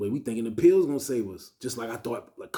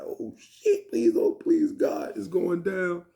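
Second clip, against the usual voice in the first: high, loud, pleading panic; mock panic; 8 semitones higher, 5 dB louder, much slower than usual. Inmate sees officers coming for the phone, mock panic. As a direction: plead loudly in hammed-up dread.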